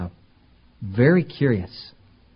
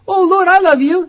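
very high, loud gushing devotion; mock devout believer; 9 dB louder than usual. Mimics a professing believer.

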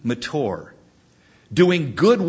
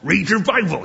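put-on higher, jaw-out old professor repeating his answer; imitating Doctor Ebling; 6 semitones higher than usual. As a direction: brush off a question like a crusty old teacher. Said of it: "he would say".

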